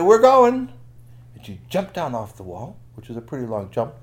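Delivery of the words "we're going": high, loud launch cry; storybook heroine calling out in triumph; exultant shout. Acted. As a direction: holler it as you leap. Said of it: "She said".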